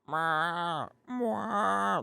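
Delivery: put-on high, yelled meow of a baby dinosaur. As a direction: howl it like a lost little dino. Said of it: "The dinosaur is literally going like".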